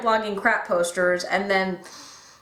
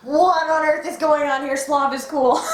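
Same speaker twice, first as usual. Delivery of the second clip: excited disbelief; 5 semitones higher than usual. Retold or imitated. Fan reacting to the cool alternate Slav, excited and amazed. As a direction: gush like a stunned fan. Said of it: "just like".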